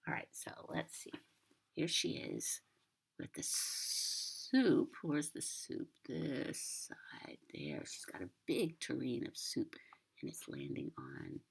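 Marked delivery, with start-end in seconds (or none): none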